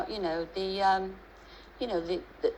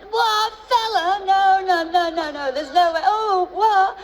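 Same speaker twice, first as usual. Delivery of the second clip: much higher, louder, slow disbelieving protest; outraged sons; 11 semitones higher, 7 dB louder than usual. Retold or imitated. Sons refusing a new man for mum, high outraged cry. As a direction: shriek out the lads' outrage. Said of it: "if she brings a fella back".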